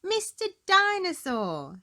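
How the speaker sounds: high, loud, slow and thrilled; excited cry. A narrator's reading. Peppa guesses George's present, excited. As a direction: shout it out with glee. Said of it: "she cried".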